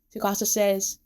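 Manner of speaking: plain reading voice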